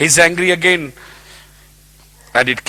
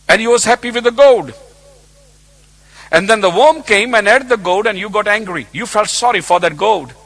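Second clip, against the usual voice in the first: preaching build; 7 semitones higher, 5 dB louder than usual. Acted. Preaching at full force.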